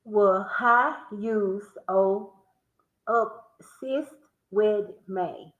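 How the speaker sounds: each word slow and separate; slow sound-out. Shown as a demonstration. Reading the word puzzle aloud, slow and spaced. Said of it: "I'll say it out loud".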